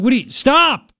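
high mock-stern command; scolding dad. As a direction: act out cutting off a screaming child. Imagined dad stopping the screaming.

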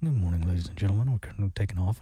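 very low, murmured pilot announcement; subdued laid-back pilot. Acted. Host imitating pilots for the co-host, subdued and laid back. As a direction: sink deep and drowsy like a laid-back captain. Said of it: "very subdued, like, laid back".

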